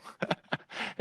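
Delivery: short, quiet mock chuckle; imitated chuckle. Colleague sitting in the office, imitated chuckle. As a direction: give his knowing little laugh. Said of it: "he would laugh".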